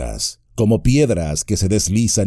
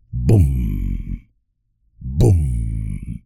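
low, booming voiced drumbeat; deep drum boom; 10 semitones lower than usual. A narrator's reading. Narrator booming out the drums of Moria, deep and distant. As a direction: boom it out like a far-off war drum. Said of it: "El redoble sacudió las paredes".

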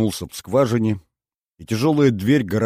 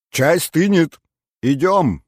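louder, higher reply; loud call back; 9 semitones higher, 7 dB louder, slower than usual. A narrator's reading. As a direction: shout back to the other room. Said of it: "громко отозвалась Сулима". (громко отозваться)

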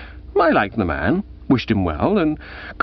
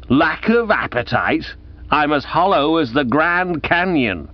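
rasping bark; 6 semitones higher than usual. Acted. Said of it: "He barked raspingly".

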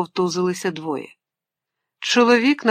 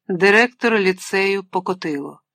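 plain reading voice

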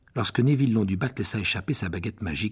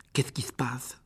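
chuchoté; 6 dB quieter than usual. Acted. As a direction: question chuchotée. Ron questionne Ginny inquiète. (chuchotement inquiet)

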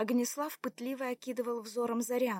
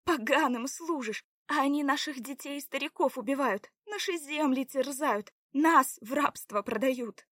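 accusing; 5 semitones higher, 6 dB louder than usual. A narrator's reading. Заряна accuses him of serving invaders, indignant.

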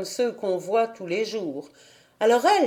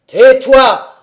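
booming shout, much louder; thundering voice; 3 semitones higher, 14 dB louder than usual. A narrator's reading. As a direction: thunder it at her. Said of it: "d'une voix de tonnerre".

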